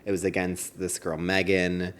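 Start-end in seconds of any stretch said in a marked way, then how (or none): none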